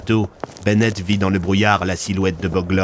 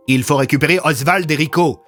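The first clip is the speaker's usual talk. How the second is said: higher, pressing call; urgent exclamation; 6 semitones higher than usual. A narrator's reading. Andrew realises companions are missing, urgent exclamation. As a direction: urge the group to act. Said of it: "s'exclama Andrew".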